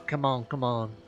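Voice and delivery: ordinary talk, nothing marked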